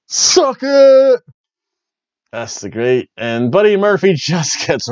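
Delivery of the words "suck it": loud, high-pitched wrestler's taunt; imitated catchphrase shout. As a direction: belt it out like a ring catchphrase. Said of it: "first thing he did".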